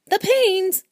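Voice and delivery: high, loud incredulous echo of the name Paynes; shocked echo. Acted. Husband aghast at his wife calling the Paynes, in a high loud incredulous echo. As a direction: throw the word Paynes back in disbelief.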